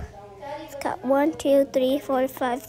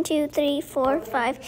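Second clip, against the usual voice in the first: ordinary talk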